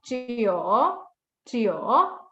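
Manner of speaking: CHIO dipping then rising on an open o; open-mouthed CHIO. Shown as a demonstration. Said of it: "Open your mouth".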